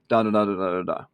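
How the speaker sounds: mock monotone da-da-da; bored nonsense syllables, lightly voiced; droning filler. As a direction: drone it like dull text. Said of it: "this just being a wall of text and saying".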